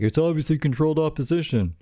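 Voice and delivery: a mocking know-it-all commenter; mocking the haters. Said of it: "So people are like".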